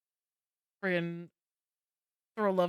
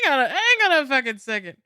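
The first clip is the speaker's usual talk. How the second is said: shocked; 7 semitones higher, 10 dB louder than usual. Real, not acted. Disbelief continues.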